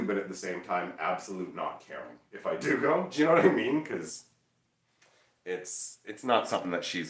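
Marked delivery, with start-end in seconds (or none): none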